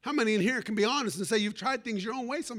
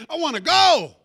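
put-on loud, higher, whiny cry of a teenager; frustrated teen outburst; 5 semitones higher, 12 dB louder than usual. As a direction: burst out like a fed-up teenager. Teen pleading with dad.

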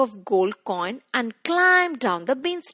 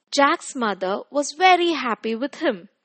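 usual speaking voice

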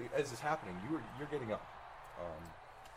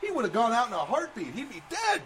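high, loud stunned commentator; much higher and louder, slowed disbelief; 13 dB louder than usual. Acted. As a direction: shout it out like an announcer. Commentator reacts to laser blast.

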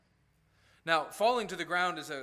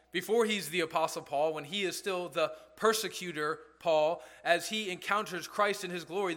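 ordinary talk

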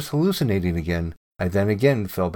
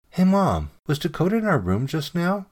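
higher-pitched question to a parent; asking Mom; 8 semitones higher than usual. A narrator's reading. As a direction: call to Mom and ask, pitched up. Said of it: "so I asked her".

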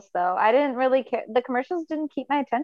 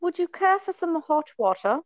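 attempted British-style accent, higher pitch; UK or Antipodean accent; 5 semitones higher than usual. Contestant repeating the waiter line for the judge, attempted British-style accent. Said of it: "Can we hear it again?".

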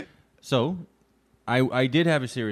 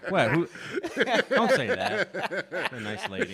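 laughing protest; 8 semitones higher, slower than usual. Real, not acted. Mock offended, laughing.

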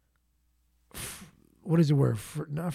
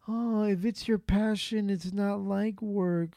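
mocking quote; 5 semitones higher than usual. Mocking people who call work passion.

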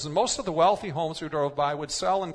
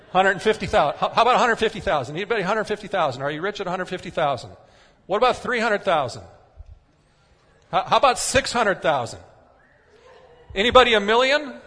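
fast, loud auctioneer patter with laughs; 3 semitones higher, 7 dB louder, faster than usual. Pretend. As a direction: call out bids like an auctioneer. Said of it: "I'm gonna feel like an auctioneer here".

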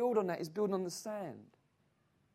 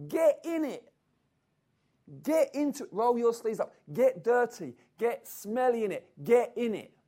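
louder, punched commands; fiery exhortation; 6 dB louder than usual. Acted. Preacher urging the church into the world, louder and punchy. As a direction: drive the congregation to act.

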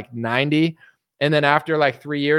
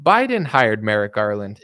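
normal talking voice

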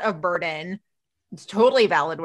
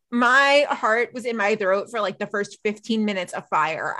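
usual speaking voice

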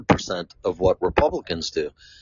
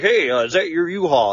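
higher, chirpy imitation of a curious old guy; mock nosy stranger; 8 semitones higher than usual. Stranger at the gas station asks about his U-Haul, higher mock voice. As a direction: play the nosy stranger, pitched up.

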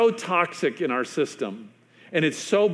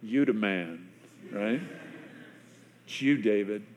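low, much softer, grave; quiet accusation; 7 semitones lower, 8 dB quieter than usual. Retold or imitated. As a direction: let it land softly and gravely.

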